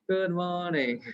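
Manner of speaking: a light Hong Kong English accent. Shown as a demonstration. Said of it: "Let's speak in Hong Kong accent".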